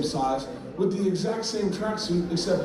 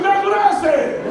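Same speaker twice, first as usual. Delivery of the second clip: high, loud, drawn-out holler; vindicated shopper; 9 dB louder than usual. Acted. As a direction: shout it in outraged agreement. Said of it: "He goes".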